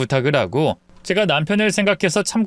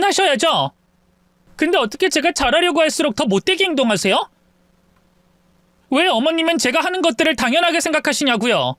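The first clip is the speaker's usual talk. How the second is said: higher, indignant daughter-in-law; daughter-in-law finally speaking up, higher and indignant; upset daughter-in-law; 9 semitones higher than usual. Acted. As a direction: confront the mother-in-law, stung and fed up. Wife airs her grievances.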